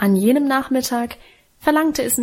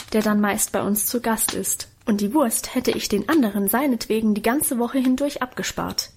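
plain reading voice

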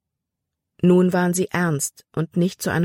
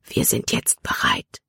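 hushed, voiceless whisper; whispered under the breath. A narrator's reading. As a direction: whisper it like a secret. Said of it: "flüsterte er".

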